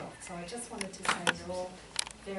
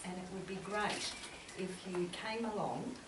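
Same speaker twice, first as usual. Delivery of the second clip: normal talking voice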